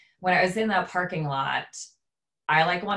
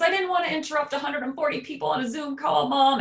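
higher, louder tearful protest; imitating tearful wail; 11 semitones higher, 3 dB louder than usual. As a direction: wail it out like a crying boy. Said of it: "And he's sobbing".

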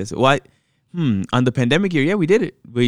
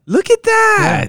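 delighted surprise; 4 dB louder, slower than usual. Real, not acted. Delighted reaction, slow and high.

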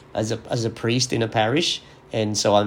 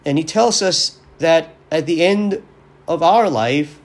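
raised, higher preaching voice with rising urgency; 4 semitones higher, 4 dB louder than usual. Acted. Priest preaching to his listeners on Christ the King, earnest and raised. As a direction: preach it, lifting the key points. Homily continues.

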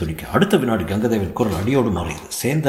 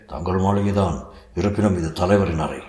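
plain reading voice

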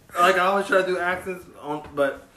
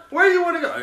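higher, laughing "Where you wanna go?"; laughing question; 10 semitones higher than usual. Real, not acted. Host defending his joke to cohost, laughing. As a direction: ask it while cracking up.

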